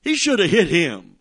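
ordinary talk, nothing marked

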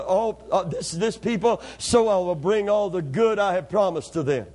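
measured reading of the text with a rising peak; steady Bible reading; scripture reading. Acted. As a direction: read the verses out to the congregation. Reads scripture aloud.

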